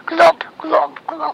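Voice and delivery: loud hooves glop, glop; playful loud hoofbeats; clopping horse feet. Retold or imitated. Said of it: "he can sound like horse feet".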